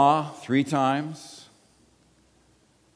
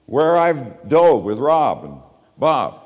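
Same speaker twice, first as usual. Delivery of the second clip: slow, louder show-off boast; mock bragging; 6 dB louder, slower than usual. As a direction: drag it out like a proud brag. Bragging about diving trips.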